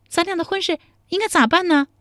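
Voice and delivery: high, quick pressing question; anxious young woman. Acted. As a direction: urge him, getting impatient. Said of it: "陶三春有些急了". (着急地追问)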